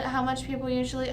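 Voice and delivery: ordinary delivery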